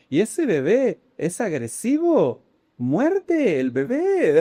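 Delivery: put-on very drawn-out sing-song Argentine child; little girl's Argentine accent. Small niece asking if baby bites, imitated Argentine accent. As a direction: stretch each word in an Italian-tinged Argentine lilt. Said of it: "así habla la niña".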